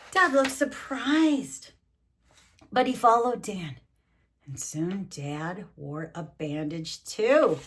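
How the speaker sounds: big slow picture-book narration; reading to children. Acted. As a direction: stretch it out for little ears. Story narration.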